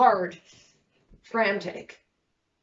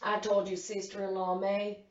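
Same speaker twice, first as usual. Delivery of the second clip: usual speaking voice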